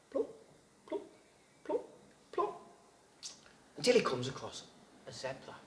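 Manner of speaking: ordinary delivery, nothing marked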